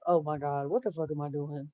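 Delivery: half-mock murmur of dismay; hushed self-talk. Retold panic, caught vaping and smoking.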